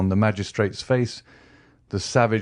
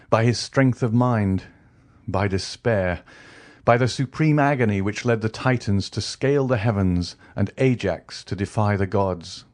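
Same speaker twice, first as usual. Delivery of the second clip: plain reading voice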